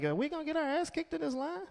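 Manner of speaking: high, soft, nervous question; worried girls. Retold or imitated. Shelter women worried in the lunch line, nervous. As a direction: ask it nervously, under the breath. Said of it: "All the girls like".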